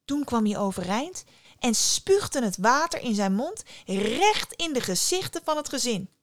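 ordinary delivery, nothing marked

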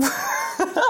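short high airy laugh; a quick giggle at herself; light laugh. Real, not acted. Laughing at own train joke. (korte hoge lach)

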